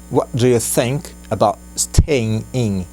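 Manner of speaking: each word drawn out and spaced; very slow. Shown as a demonstration. Teacher slowing the sentence right down. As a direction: spell it out slowly for learners. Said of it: "very slow model take".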